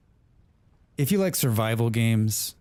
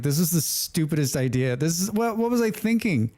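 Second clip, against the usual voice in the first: frustrated self-quote; 9 semitones higher than usual. Retold or imitated. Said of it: "I was like".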